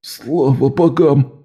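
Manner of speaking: slow, strained croak; hoarse croak. Acted. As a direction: croak it out weakly. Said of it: "прохрипел Лок". (хриплое, медленное слабое восклицание)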